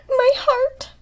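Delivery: choked up by the story; teary. Real, not acted. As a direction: voice catching, moved to tears. Emotional reaction to story.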